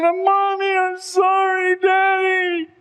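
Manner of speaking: high, slow, wailing sorry; weeping apology. Retold or imitated. Preacher voicing the boy sobbing to his dead parents. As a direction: sob it to the parents he killed. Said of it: "weeping bitterly, uncontrollably".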